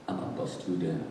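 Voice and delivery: very low, hushed vow; soft, low threat to the wall; saying to oneself. Retold or imitated. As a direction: mutter it privately under your breath. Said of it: "I'm saying to myself".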